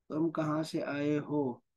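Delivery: ordinary talk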